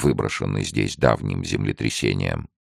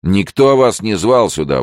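loud, high indignant retort; hostile retort; 9 semitones higher, 7 dB louder than usual. A narrator's reading. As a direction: snap back at an unwelcome visitor. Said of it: "возразил незнакомец". (резкое возражение)

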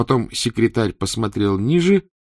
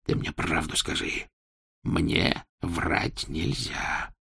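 much softer, very slow threat; quiet menace; 2 semitones lower, 7 dB quieter, much slower than usual. Acted. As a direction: press him softly, menacingly. Interrogator quietly pressing.

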